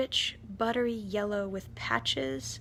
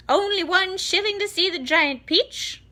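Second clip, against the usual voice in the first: loud, much higher cry; barker's yell; 10 semitones higher, 9 dB louder than usual. A narrator's reading. Aunt Spiker selling tickets to the crowd, loud and high. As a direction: sell it to the crowd loudly. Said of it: "Aunt Spiker yelled".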